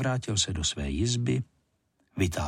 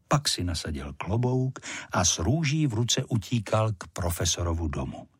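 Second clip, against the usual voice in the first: plain reading voice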